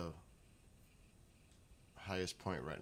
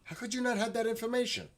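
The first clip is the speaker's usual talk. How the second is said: much higher, louder outraged question; exasperated; 8 dB louder, faster than usual. Real, not acted. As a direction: disbelief at a useless gadget. Frustrated at Alexa.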